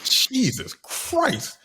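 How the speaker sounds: high, very slow drawn-out Jesus Christ; mock stunned gasp of Jesus Christ; awed exclamation. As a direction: stretch it out in stunned awe. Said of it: "I was like".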